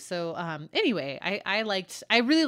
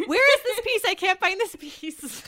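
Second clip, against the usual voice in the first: frantic asking; 11 dB louder than usual. Retold or imitated. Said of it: "you were asking on Twitter".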